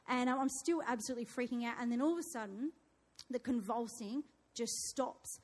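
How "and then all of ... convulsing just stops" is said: ordinary talk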